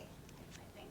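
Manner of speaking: unsure, breathy whisper trailing off; faltering whisper. Acted. Grieving Kat admits to Nora, in a faltering whisper. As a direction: let the doubt drop to a whisper.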